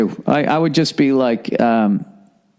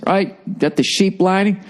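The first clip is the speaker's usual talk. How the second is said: normal talking voice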